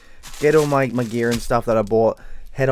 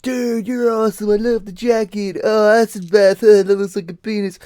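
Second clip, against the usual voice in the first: high, loud mock drunken gushing; put-on drunk fanboy holler; drunk metalheads; 11 semitones higher, 6 dB louder than usual. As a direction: imitate pissed metalheads bellowing compliments at a stranger. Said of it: "drunk metalheads who are like".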